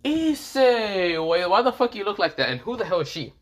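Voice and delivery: high, alarmed voice; startled. Acted. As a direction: react in shock at your old friend. Sanji startled by Issei's look.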